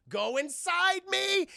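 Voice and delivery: high, slow and drawn-out; drawn-out invitation. Retold or imitated. Randall invites Xanatos inside.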